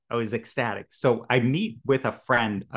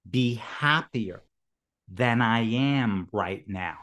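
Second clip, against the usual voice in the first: slow revelation; slower than usual. Retold or imitated. Slow, deliberate revelation quote.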